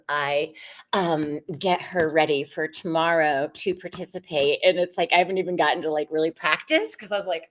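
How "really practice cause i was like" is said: ordinary delivery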